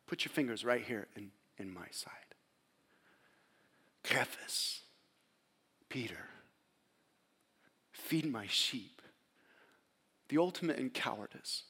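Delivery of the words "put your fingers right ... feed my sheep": soft, whispered invitation; gentle whisper. Acted. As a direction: coax them tenderly, almost silent. Jesus to Thomas and Peter.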